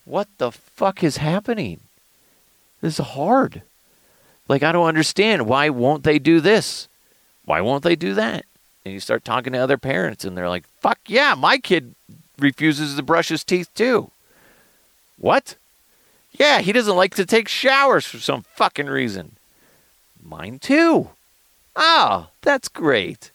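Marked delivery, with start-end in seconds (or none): 0.0-8.4 high-pitched acted exasperation; frazzled parent at a loss; overwhelmed parent. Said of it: "you just feel alone". 10.8-23.3 high, eager relief at shared troubles; acted parents delightedly swapping complaints; excited commiserating.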